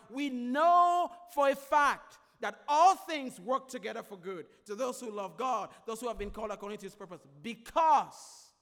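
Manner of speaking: loud ringing sermon refrain, each line hammered; preaching at full force. Acted. Preacher proclaiming to his congregation, loud and ringing. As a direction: preach it out to the congregation like a rallying cry.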